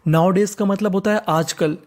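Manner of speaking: ordinary talk, nothing marked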